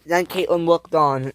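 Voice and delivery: ordinary delivery, nothing marked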